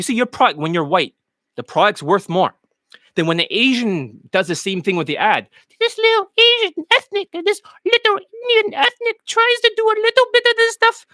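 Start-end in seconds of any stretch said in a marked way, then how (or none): 5.8-11.1 high-pitched mock Indian accent, raised way up. Coach mocking students in a high put-on Indian accent. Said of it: "trying to talk in, like, an Indian accent".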